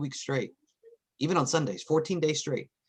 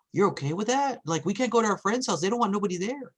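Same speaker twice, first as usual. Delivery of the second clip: put-on higher, incredulous kids' voice; kids amazed; 7 semitones higher than usual. Kids surprised their dad lets friends over, higher and incredulous. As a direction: sound stunned that dad says yes. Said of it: "they're like".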